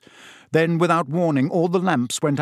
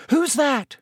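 put-on startled girl; 13 semitones higher than usual. Ginny startled, high voice.

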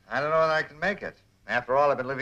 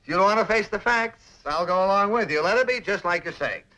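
higher-pitched mock surrender; humoring concession; 7 semitones higher than usual. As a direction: give in teasingly, pitched up. Mock concession to annoyed friend.

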